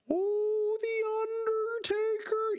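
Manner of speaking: very high, drawn-out mock wail; high-pitched Paul Bearer. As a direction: squeal the name like a spooky manager. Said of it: "you know, that high-pitched kind of voice".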